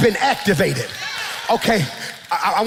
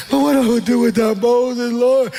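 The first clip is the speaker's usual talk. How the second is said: pleading Joshua. Acted. Joshua voiced in grief.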